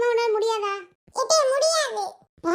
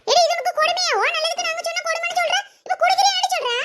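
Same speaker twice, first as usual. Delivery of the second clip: scolding mother; 7 semitones higher than usual. Acted. Mother snaps at defiant daughter.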